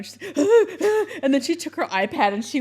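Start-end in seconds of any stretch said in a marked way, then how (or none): 0.4-1.2 high, loud mock gasp of a crying child; imitated sobbing gasp. Mother imitating her crying daughter, a high loud gasp. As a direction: gulp air like a sobbing kid.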